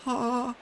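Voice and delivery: thin, high-pitched failed scream "ha"; a scream that comes out as a high "ha"; failed scream attempt. Shown as a demonstration. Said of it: "whenever I've tried to scream".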